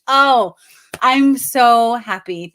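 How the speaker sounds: high, loud, slow burst of real joy; overjoyed. Real, not acted. Reader thanked by a moved viewer, real joyful outburst. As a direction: let the happiness spill out, drawn out.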